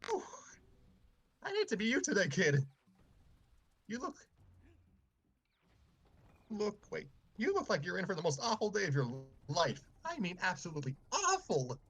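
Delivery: ominous warning janitor; gruff custodian. Acted. Custodian character voice.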